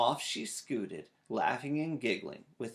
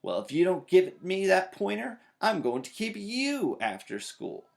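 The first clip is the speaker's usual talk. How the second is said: raised, higher mocking threat; loud threat; 7 semitones higher, 5 dB louder than usual. A narrator's reading. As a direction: turn her threat back on her. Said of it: "shouted Sister".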